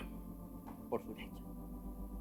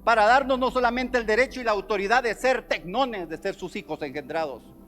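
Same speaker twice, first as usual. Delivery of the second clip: preaching at full force; 6 semitones higher, 10 dB louder, slower than usual. Acted.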